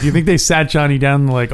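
normal talking voice

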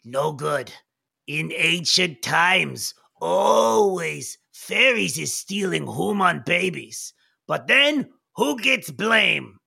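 slow, portentous goblin elder; Old Knob Jinkies. Acted. Goblin elder recalling ancient times, slow and portentous. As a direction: stretch the words like an old storyteller. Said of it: "Old Knob Jinky says".

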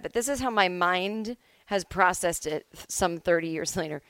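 usual speaking voice

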